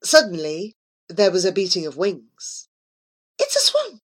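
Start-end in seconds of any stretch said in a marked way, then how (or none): none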